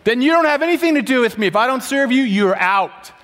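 Jesus telling Peter he's off the team; firm ultimatum. Retold or imitated. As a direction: lay down the ultimatum. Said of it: "Here's what Jesus says".

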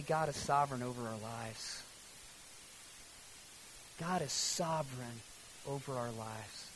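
low, soft, solemn sermon; solemn preaching. Real, not acted. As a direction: bring it down to a hushed, grave reflection. Solemn turn to the recent tragedy.

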